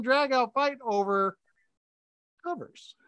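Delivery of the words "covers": soft unvoiced whisper of the punchline. Real, not acted. Husband whispering the silly cause of the fight, under his breath. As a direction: drop to a whisper for the absurd point.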